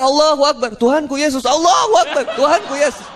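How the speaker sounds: high, alternating frantic cries; mock panicked praying. Two passengers pray in turbulence, mock panic.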